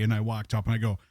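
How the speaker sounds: usual speaking voice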